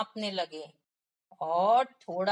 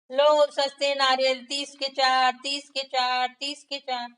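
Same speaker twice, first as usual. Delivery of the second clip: loud market cry, higher, selling cheap coconuts; hawker calling out; 5 semitones higher, 6 dB louder than usual. Acted. Market seller shouting his prices to passers-by, loud. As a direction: call out the price to the whole market. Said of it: "और चिल्ला रहा था".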